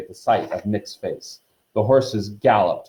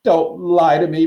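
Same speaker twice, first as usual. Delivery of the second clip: high, loud, wounded protest; hurt, wailing ghost girl; tearful gasp; 8 semitones higher, 6 dB louder than usual. A narrator's reading. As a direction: accuse through tears. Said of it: "Myrtle gasped".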